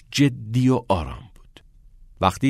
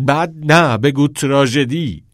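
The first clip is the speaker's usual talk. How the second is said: louder, slow melodrama; dramatic; 4 semitones higher, 6 dB louder than usual. A narrator's reading. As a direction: make it a tragedy. Knox dramatizes his plight.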